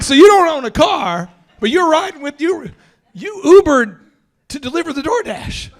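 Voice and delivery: high, loud, spelling out the absurdity; hammering home the disbelief; loud incredulous. Retold or imitated. Quoting himself to the delivery guy, incredulous and loud.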